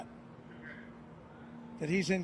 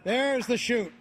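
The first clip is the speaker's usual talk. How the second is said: excited; 5 semitones higher, 4 dB louder than usual. Real, not acted. Parachute opens during live jump.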